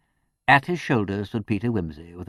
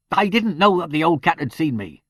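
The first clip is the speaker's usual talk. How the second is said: a touch of fury; 8 semitones higher, 6 dB louder than usual. A narrator's reading. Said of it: "then furiously".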